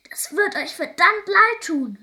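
high, loud outraged yell; little girl yelling in fury; defiant shout. A narrator's reading. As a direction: shout a threat at your captors. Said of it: "schrie Molly".

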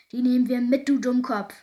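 gruff pirate captain, loudly irritated; loud, impatient pirate growl. A narrator's reading. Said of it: "knurrte Knitterbart".

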